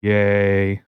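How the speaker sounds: drawn-out, toneless mock cheer; droning impression with no lift; Ben Stein monotone. Host imitating the monotone actor cheering, in a drone. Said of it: "he was quite monotone".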